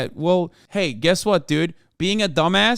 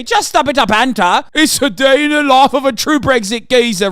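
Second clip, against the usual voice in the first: mock British geezer; 9 semitones higher, 7 dB louder than usual. Mocking British police as geezers.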